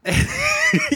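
a sudden high, bright laugh; one quick, high burst of laughter. Real, not acted. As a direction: crack up at the joke. Laughs at the kangaroo joke.

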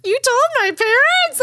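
very high, loud mock-tearful squeal; overwhelmed, crying-voice exclamation, acted out; imitated ugly crying. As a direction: wail it like a hammed-up ugly cry. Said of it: "I'm, like, ugly crying at this point".

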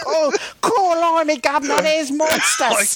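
mock a terrible British accent, high and silly; bad British accent. Host mocks his own British accent to co-host, high hammed-up Cockney British. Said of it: "trying to put on a British accent".

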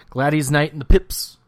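"Gladys" said loudly as GLAD-eez; spelling pronunciation. Shown as a demonstration. Host defiantly announcing the act, "Gladys" as GLAD-eez. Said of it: "it should be pronounced Gladys".

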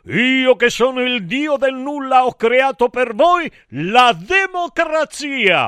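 high, grand declamation; proclaiming cry. A narrator's reading. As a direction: announce your creation to the gods. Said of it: "gridai a tutti gli dei dell'Olimpo".

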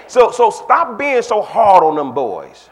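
usual speaking voice

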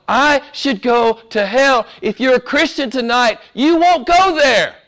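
ordinary talk

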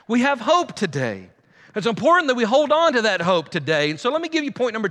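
rally the church toward hope; preaching. Acted. Pastor to his church, building preaching.

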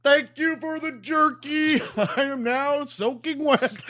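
high, loud, slow bellow breaking into laughs; Matt Foley. Acted. Soaked hitchhiker thanking them for jerky, hammed-up high bellow. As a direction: ham up the booming impression. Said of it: "my Matt Foley's a little rusty".

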